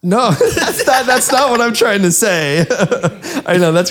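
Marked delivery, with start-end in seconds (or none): 0.0-2.7 high, laughing no, that's not; laughing protest. Real, not acted. Laughing as she tells her co-host that's not what she means. 2.7-3.4 short high burst of laughter. Real, not acted. Laughing at her co-host's teasing remark, high laugh.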